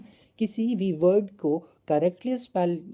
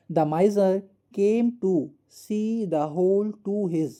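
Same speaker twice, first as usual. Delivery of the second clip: slow dictation; 3 semitones higher, much slower than usual. Shown as a demonstration. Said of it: "फिर से सुनिए".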